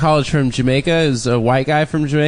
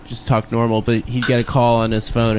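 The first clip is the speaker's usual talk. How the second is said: ordinary delivery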